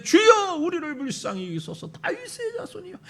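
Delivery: pleading shout breaking into a sob; desperate wail for mercy; crying out. Acted. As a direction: cry out to the Lord with everything. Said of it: "더욱 소리를 질러 이르되".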